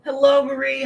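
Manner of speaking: high, loud hello to a viewer; loud cheery hello. Real, not acted. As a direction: call out her name happily. Greeting a viewer by name.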